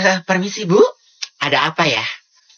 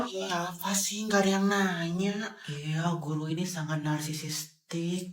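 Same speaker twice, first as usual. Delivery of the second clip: hushed student asides; 8 dB quieter, slower than usual. Acted. Students mutter about teacher.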